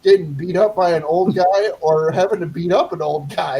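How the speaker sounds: normal talking voice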